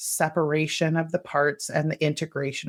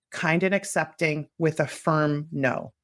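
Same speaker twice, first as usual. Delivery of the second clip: usual speaking voice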